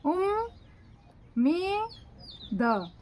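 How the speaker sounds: उम, मी, द split into separate beats; teacher breaking उम्मीद into syllables. Shown as a demonstration. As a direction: spell उम्मीद out piece by piece. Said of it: "देखो".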